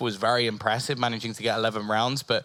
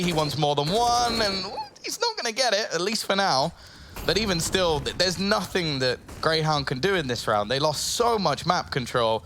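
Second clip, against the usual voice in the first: esports caster; 5 semitones higher than usual. Real, not acted. Caster hyped during clutch play-by-play.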